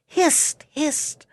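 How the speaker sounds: high hushed hissing call. A narrator's reading. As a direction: hiss the warning high and soft. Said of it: "called out a second time".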